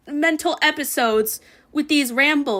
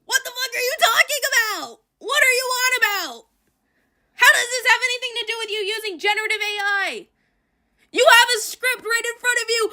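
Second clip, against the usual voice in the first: high, incredulous exasperated rant; 8 semitones higher than usual. Real, not acted. Streamer yelling at creator to just read the script. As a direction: demand it in disbelief, voice climbing high.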